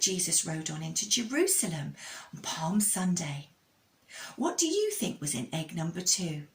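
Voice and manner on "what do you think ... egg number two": ordinary delivery, nothing marked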